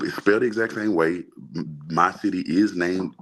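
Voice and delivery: ordinary talk, nothing marked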